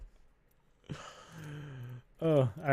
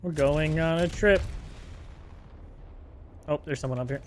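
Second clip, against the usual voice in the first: usual speaking voice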